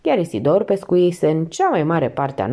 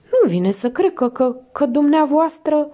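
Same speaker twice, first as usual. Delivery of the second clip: higher, stammering and uneasy; a hint of flustered landlady; 9 semitones higher than usual. A narrator's reading. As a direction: stumble over the words, uneasy. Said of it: "era cam neliniștită".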